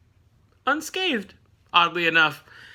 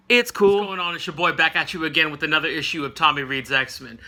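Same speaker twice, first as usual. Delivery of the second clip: usual speaking voice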